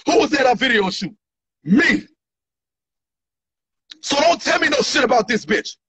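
usual speaking voice

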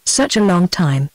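ordinary delivery, nothing marked

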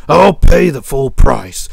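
loud, demanding sheep voice; a sick sheep's forceful plea; sick sheep crying back. Acted. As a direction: cry back loudly, insisting on help. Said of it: "The sick sheep cried back".

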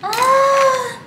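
a loud high "ah" of dismay; sudden high cry. Real, not acted. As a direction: yelp it out suddenly.

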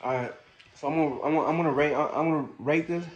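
talking between bites; chewing while talking. Real, not acted. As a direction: mouth full of food. Seen 0: Holds burger, chews while talking; takes bite near +5.

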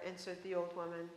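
ordinary delivery

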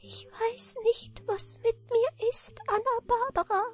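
very high, thin, feeble creature voice; weak-voiced little manikin. A narrator's reading. Little man begs Anna Barbara for bread, weak voice. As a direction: plead weakly in a tiny falsetto. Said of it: "sprach das Männchen mit schwacher Stimme".

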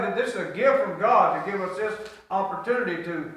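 ordinary delivery

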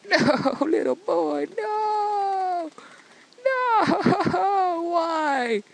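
hammed-up grief, high drawn-out cries; mock wailing. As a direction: wail it slowly like a melodramatic mourner. Melodramatic mourning of game child.

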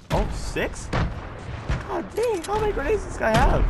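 very high disbelieving shriek; shrieking jackpot winner. Real, not acted. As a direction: squeal in amazement. Amazed at grenade count.

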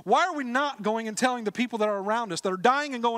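high, loud pulpit exhortation; fervent sermon call to act; full-force preaching. Acted. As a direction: urge the church to go out.

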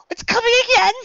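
very high, loud panicked wail; panicked cry. A narrator's reading. Labor pain panic.

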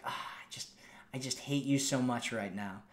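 playful tease. Shown as a demonstration. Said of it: "make sure it's playful".

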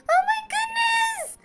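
high, slow, delighted squeal of joy; excited squeal. Real, not acted. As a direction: shriek it with real delight. Finds a fan in chat.

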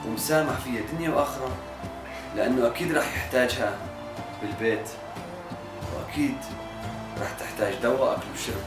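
low, soft, gentle voice; calm forgiveness. Acted. Mohammad gently forgiving his friend, soft and low. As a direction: reassure him quietly that all is forgiven.

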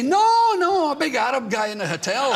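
high, loud and laughing; loud laughing mock confession; mock eager answer. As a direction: blurt the joke answer too keen. Joking answer to airport security.

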